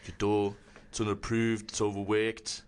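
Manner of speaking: put-on low British baking-judge accent; deep, measured British verdict on a bake; Paul Hollywood. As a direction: mimic a British TV bake-off judge, pitched well down. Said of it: "I've been doing the Paul Hollywood".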